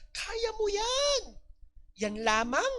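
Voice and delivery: high, slow mock encouragement; mock pep talk. Professor teasing a failing student, slow high mock cheer. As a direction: stretch it out like a teasing coach. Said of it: "minomotivate ko sila".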